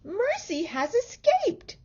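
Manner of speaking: wife seeing the pig escape; excited wife. A narrator's reading. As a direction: announce the news breathlessly. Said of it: "said Missus Watson".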